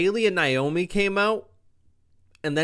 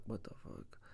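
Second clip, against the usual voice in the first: breathless stunned whisper; hushed what-the-heck; whispered shock; 5 semitones lower, 20 dB quieter than usual. Retold or imitated. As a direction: mouth it in disbelief. Carmelo's stunned reaction.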